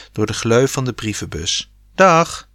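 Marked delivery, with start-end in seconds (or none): 2.0-2.5 high, loud farewell call; shouting after them. A narrator's reading. Boy shouting after posted cards, raised call. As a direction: shout goodbye after something departing. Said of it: "schreeuwde hij ze na".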